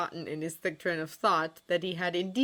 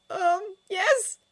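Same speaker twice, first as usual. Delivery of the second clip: high, loud nervous squeak; timid squeak, pitched way up; 7 dB louder than usual. A narrator's reading. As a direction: squeak it out, afraid of the question. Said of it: "he squeaked".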